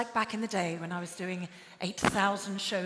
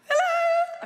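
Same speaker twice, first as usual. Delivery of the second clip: very high, loud squeaky hello; giddy greeting; 7 dB louder than usual. Pretend. Host spots someone who makes her giddy, squealed greeting. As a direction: squeal it like a smitten fan. Flirty greeting to spotted star.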